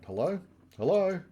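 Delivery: mock-puzzled phone greeting, high and rising; high puzzled hello. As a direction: call into a silent phone, voice pitched well up. Buffy answering silent phone call.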